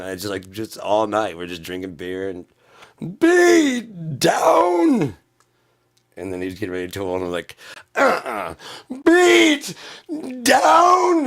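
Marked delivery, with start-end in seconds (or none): 3.2-5.2 high, loud, drawn-out victory shout; triumphant bellow with a cry in it; gloating yell. Retold or imitated. Gloating over a win. 7.9-11.3 high, loud, drawn-out victory shout; triumphant bellow with a cry in it; gloating yell. Retold or imitated. Gloating over a win again.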